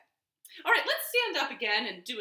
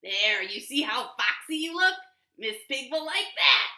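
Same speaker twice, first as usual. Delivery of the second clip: louder, sly fox voice offering a favour; 3 semitones higher, 7 dB louder than usual. Acted. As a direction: talk the pig into it, smooth and loud. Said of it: "said the fox".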